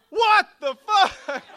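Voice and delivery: very high, loud, drawn-out mock shriek; stretched falsetto outburst of shock; shocked squeal. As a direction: squeal it slowly in hammed-up disbelief. Reacts to being asked about AIDS.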